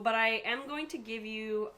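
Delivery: ordinary delivery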